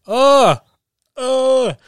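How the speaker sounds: high, loud disgusted "ugh"; loud mock groan; imitated grunt. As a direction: groan it out sharply. Imitating reaction to nose scene.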